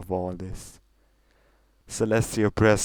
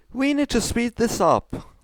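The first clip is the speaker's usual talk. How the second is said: grumbling; 5 dB louder than usual. Acted.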